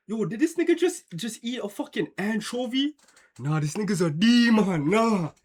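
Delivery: high, incredulous joking voice; mock disbelief. Roasting a friend over what he ate, mock disbelief. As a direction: act shocked at your friend as a joke. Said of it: "cracking some joke shit".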